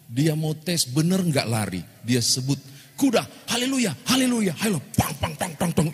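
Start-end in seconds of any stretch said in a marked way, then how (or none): 3.0-4.9 calls haleluya high, spurring on; high excited urging. Retold or imitated. Pastor spurs the horse with high excited cries. 5.0-5.9 hoofbeat noises. Retold or imitated. Galloping hooves imitated.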